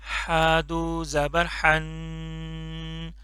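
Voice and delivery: हन with a light, soft breathy h, very slow. Shown as a demonstration. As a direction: drawl हन gently and softly. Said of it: "इसको बिल्कुल soft करके पढ़ना है". (हन हल्का, नरम करके, धीरे)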